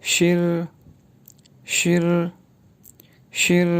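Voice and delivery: शिर said with a curled sh, the middle one whispered; शिर, retroflex sh and a curled r; शिर like the Mandarin syllable shi; pinyin shi. Shown as a demonstration. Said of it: "एस एच की sound".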